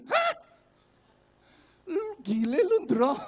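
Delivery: very high, loud comic disbelief; mock-outraged squawk at the attendant; incredulous outburst. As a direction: exclaim in hammed-up shock. Acted outraged reaction to careless answer.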